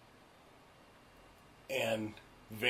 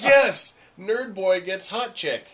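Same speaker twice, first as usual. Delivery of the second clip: high, loud cheer, hammed up; overjoyed; 8 semitones higher, 10 dB louder than usual. Moviegoer cheering when boy gets girl, overjoyed. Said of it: "So happy".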